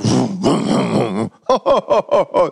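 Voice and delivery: choked crying sob; tearful sob. Retold or imitated. Father starting his truck, choked crying sob. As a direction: let the sob out.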